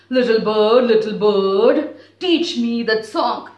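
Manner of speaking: loud sing-song call to the bird. Acted. Husky calls to the bird.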